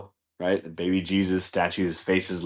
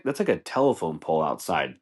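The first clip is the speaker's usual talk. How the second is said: normal talking voice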